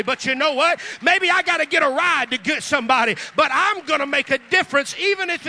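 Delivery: full-force preaching. Acted. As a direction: preach it out to the back row.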